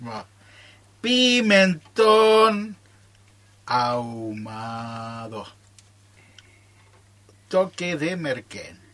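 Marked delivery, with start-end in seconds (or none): 1.0-5.5 slow dictation. Acted. Dictating the recipe while writing.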